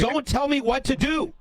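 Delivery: high, mock defiant yelp; squeaky mock-defiant impression; defiant retort. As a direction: snap back like a stubborn kid. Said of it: "And he's just like".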